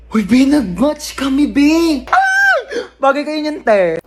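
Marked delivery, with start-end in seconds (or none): 0.1-2.0 excited. Acted. Thrilled about dating app match. 2.0-2.6 very high excited shriek; thrilled squeal. Acted. Friend thrilled by an app match, piercing squeal.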